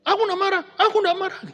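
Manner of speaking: loud, very high, indignant protest; high-toned angry denial. Acted. Spouse insists they are not angry, loud high-pitched protest. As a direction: deny your anger while plainly sounding furious. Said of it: "Tapi nadanya itu tinggi".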